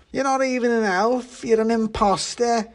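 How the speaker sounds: put-on high-pitched, outraged drunk pudding; shouted accusation. A narrator's reading. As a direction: accuse in a high tipsy wail. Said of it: "shouted the pudding".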